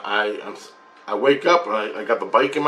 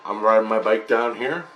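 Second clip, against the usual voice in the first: ordinary talk, nothing marked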